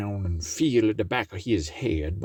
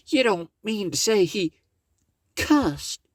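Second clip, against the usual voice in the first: pitched way up as Miss Meadows; a high exclaiming lady; 12 semitones higher than usual. Acted. Miss Meadows answering Brer Tarrypin, high and exclaiming.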